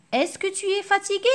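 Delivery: high loud questioning tune; model question. Shown as a demonstration. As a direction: ask the example question clearly. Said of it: "pour faire une phrase interrogative totale".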